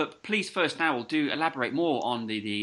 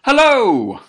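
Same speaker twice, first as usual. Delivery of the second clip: big bright hello, much higher and louder; loud cheery hello; 10 semitones higher, 13 dB louder than usual. Real, not acted. Cheery greeting down the line.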